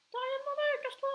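t'as rien demandé, très haut et fort; voix aiguë moqueuse. Pretend. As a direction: singe l'autre d'une voix perchée. Chasse un joueur gênant. (voix suraiguë moqueuse et forte)

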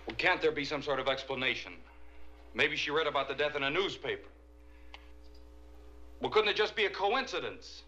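high, urgent grasping for an explanation; agitated protest. Acted. Husband agitated, pleading for another explanation.

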